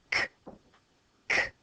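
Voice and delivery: crisp clicked "K," like a castanet; castanet k sound. Shown as a demonstration. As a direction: snap out "k." short and dry. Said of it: "castanets... go".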